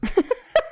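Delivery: short high-pitched yelps; quick little squeaky yap; imitated dog yapping. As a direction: imitate a small dog's sharp yap. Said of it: "it's like this".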